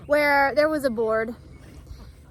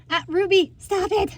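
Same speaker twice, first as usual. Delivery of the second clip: high, raised telling-off; exclaimed scolding; 7 semitones higher, 5 dB louder than usual. Real, not acted. Owner telling dog Ruby to stop it, high scolding. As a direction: call the dog off sharply and high.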